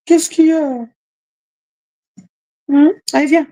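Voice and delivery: tender pet talk. Real, not acted. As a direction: coax your pet gently in French. Talking tenderly to her pet.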